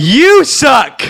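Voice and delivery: loud, high-pitched outburst; indignant shout. Acted. Band fan hitting back at a co-host, indignant shout. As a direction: yell it as a furious insult.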